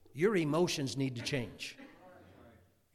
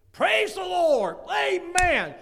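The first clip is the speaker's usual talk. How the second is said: high, very loud drawn-out shout; exuberant convert; 13 semitones higher, 12 dB louder, much slower than usual. Retold or imitated. Imitating people who get saved, jubilant shouting. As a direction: holler it out like someone just saved. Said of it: "some people get saved, they".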